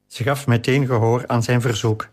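ordinary delivery

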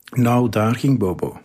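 usual speaking voice

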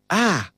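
short high cry of fright; startled scream. A narrator's reading. Titus shrieks as a rat darts past, high startled cry. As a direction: yelp as something runs over your feet. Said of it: "schreeuwde Titus".

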